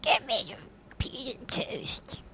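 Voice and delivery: whispered mock Australian accent. Shown as a demonstration. Host shows off his Australian accent, whispering. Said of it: "That's my Australian accent".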